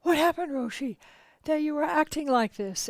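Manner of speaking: put-on high, loud alarmed voices of young students; shocked to find their teacher weeping; astonished boys. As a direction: boys bursting in, startled and worried. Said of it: "were astonished".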